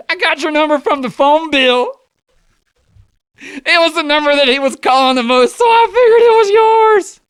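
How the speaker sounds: loud, high mock-mom voice; giddy mom. Mom confessing to the girlfriend, giddy and shrieking.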